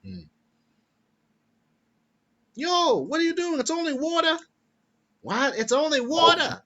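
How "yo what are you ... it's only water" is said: high, squawking mock Boston accent; bad Boston accent. Shown as a demonstration. Co-host showing off a mistaken Boston accent, high and hammed-up. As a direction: ham up a Boston-style New York accent. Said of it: "I think of Boston".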